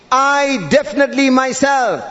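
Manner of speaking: "I definitely myself" pitched high, each word leaned on; emphatic stress. Shown as a demonstration. Preacher shows God's threefold emphasis, every word stressed in "I definitely myself". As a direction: hammer "I", "definitely" and "myself" in turn. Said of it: "Emphasis upon emphasis upon emphasis".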